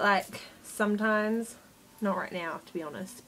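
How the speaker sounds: normal talking voice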